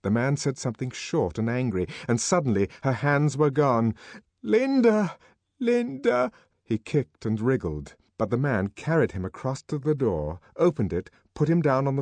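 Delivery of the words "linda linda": put-on high, loud child's cry for help; raised, urgent cry of the name; frightened calling. A narrator's reading. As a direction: call out for mother. Child calls as he is carried off.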